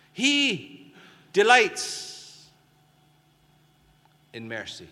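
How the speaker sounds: high, loud burst of joy; exultant proclamation. Acted. Preacher exulting that God delights in mercy, high and loud. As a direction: ring out the delight.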